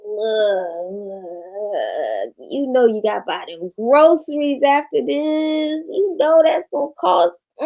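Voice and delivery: lightly voiced inner crackhead egging her on to buy. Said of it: "My voice sounds like a New Jack City crackhead".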